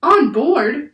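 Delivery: high, loud incredulous question; shocked echo. A narrator's reading. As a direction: throw it back in disbelief. Jack shocked by the order.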